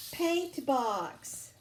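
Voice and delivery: plain reading voice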